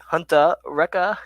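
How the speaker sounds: a light, slow imitation of a British accent; slow, lightly imitated British accent; mock British accent. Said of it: "a British accent".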